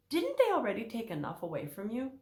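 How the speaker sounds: ordinary talk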